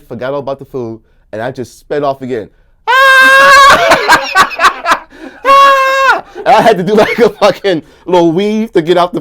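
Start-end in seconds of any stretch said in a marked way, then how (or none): 2.9-6.2 laughing scream. Retold or imitated. Screaming as he speeds off.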